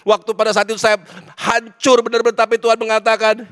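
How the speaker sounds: loud, building testimony, pitch climbing; impassioned preaching. Real, not acted. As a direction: confess past sins with rising force. Preaches at full force. (khotbah lantang yang makin memuncak)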